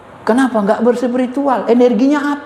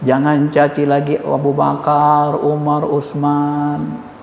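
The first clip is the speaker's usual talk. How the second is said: slow, lower, measured preaching voice; imitating a calm cleric, unhurried; imam's calm sermon; 6 semitones lower, much slower than usual. A speaker voices a calm imam urging unity, slow and low. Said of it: "Dia pun tenang ngomongnya".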